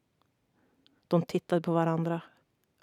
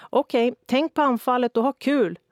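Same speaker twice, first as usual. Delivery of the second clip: high, loud call to the team; coach calling out; 7 semitones higher, 8 dB louder than usual. A narrator's reading. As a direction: send them back out with a shout. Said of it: "ropade tränaren".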